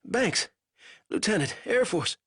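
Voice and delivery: a weak captive fumbling for words; lightly voiced delirious prisoner; feverish prisoner. A narrator's reading. Banks voice.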